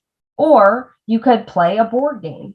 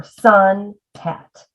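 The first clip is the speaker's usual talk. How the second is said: SUN HAT slowly, SUN held, then HAT; syllables broken apart. Shown as a demonstration. Teacher showing a two-syllable word, syllables apart. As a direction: split SUN HAT into two slow beats. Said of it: "we break apart a word into its syllables".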